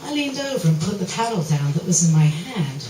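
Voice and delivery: usual speaking voice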